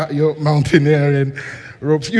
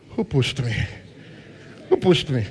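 softer, lower dazed question; a touch of bewildered murmur; 5 semitones lower, 8 dB quieter than usual. Acted. Someone stuck in life asking themselves, softly bewildered. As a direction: ask it to yourself, lost.

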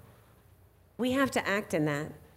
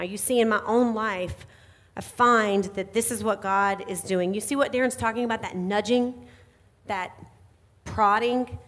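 ordinary talk, nothing marked

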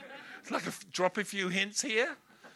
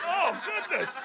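mock shock; 13 semitones higher, 5 dB louder, slower than usual. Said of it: "It's like".

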